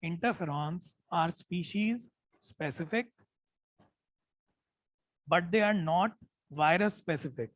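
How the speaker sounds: slow dictation. Shown as a demonstration. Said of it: "मैं जानबूझ के धीरे बोल रहा हूँ".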